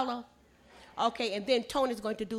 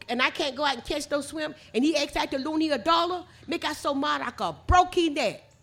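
higher Gullah storytelling; 4 semitones higher, 4 dB louder than usual. Shown as a demonstration. Storyteller voicing the angry fisherman's excuse, in Gullah. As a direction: finish the complaint in Gullah. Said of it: "Listen at it in Gullah".

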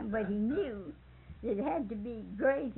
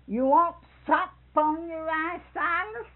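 put-on higher, louder, slower old lady's offer at the table; Sister Hall's voice; 6 semitones higher, 8 dB louder than usual. Sister Hall asking Silas at dinner, high loud slow voice.

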